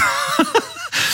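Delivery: a short, very high, soft giggle; high laugh. Real, not acted. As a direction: let out a squeaky little laugh. Laughs at her own remark. (hohes, leises Kichern)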